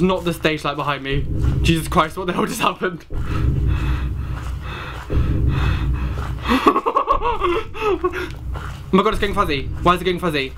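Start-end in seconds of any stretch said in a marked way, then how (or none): 3.1-8.9 high, wheezing laugh after a fright; helpless squealing laughter trailing on; long nervous laugh. Real, not acted. Player just startled by the game, long nervous laugh.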